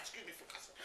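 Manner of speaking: whispered apology under the breath; whispered aside. Real, not acted.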